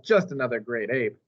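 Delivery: ordinary delivery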